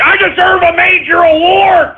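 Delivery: mock whine. Host demands respect.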